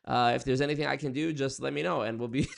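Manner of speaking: a hint of an upbeat chatbot voice; lightly mock a cheerful AI assistant; AI operator answering a 911 call, mock chipper; chirpy AI operator. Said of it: "the A I on the other end is like".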